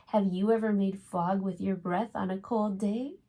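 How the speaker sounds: gentle storybook reading voice; reading to children. A narrator's reading. Reads a picture book aloud.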